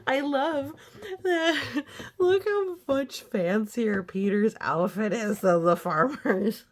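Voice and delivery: giggling through the words; real amused laughter; laughing. Real, not acted. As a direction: laugh as you talk about the picture. Laughing at picture.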